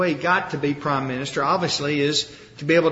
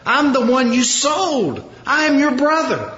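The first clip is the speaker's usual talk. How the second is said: imitated emotional outcry; 6 semitones higher than usual. Joseph revealing himself to his brothers, imitated emotional outcry. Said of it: "he cries so loud".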